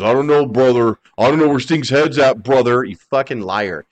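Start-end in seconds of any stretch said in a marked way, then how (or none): 0.0-2.8 lightly voiced wrestling promo guy; Hogan impression. Mocking Hogan's excuse to podcast hosts, lightly voiced impression. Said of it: "Hogan didn't wanna lose because".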